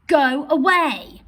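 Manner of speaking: loud stretched command with GO and AWAY hit; commanding shout. Shown as a demonstration. Teacher demonstrating a firm command to the class. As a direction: order them to go away. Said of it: "I'm emphasizing and I'm commanding".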